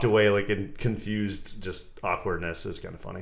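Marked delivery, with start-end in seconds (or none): none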